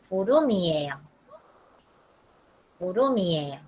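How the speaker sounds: 구름이에요 run together as gu-reu-mi-e-yo; fluent linked reading. Shown as a demonstration. Teacher modelling for students, fluently linked. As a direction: say 구름이에요 in one smooth word, M into "mi". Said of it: "Bien fluidamente ustedes van a leer".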